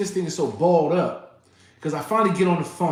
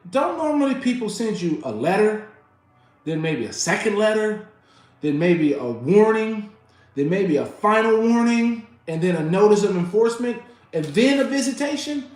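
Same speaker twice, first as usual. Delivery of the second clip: building retold yell, higher and louder; 7 semitones higher, 5 dB louder than usual. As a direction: list each step with rising outrage. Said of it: "this is where I'm yelling".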